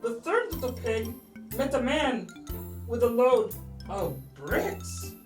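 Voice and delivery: usual speaking voice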